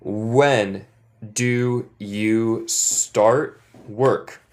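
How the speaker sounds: very slow, clear model sentence; teacher modeling slowly for the class to repeat; slow repeat-after-me. Shown as a demonstration. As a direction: say it slowly so the class can echo. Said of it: "Repiten después de mí".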